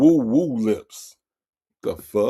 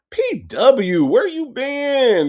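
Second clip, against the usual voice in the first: excited greeting; 11 semitones higher, 4 dB louder, much slower than usual. Real, not acted. Greeting a chat member.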